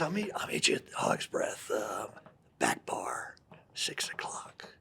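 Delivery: low hushed whisper, slowing down; hushed Clint quote. Retold or imitated. Clint whispering a bar invite to his playing partner. Clint quietly sets up meeting.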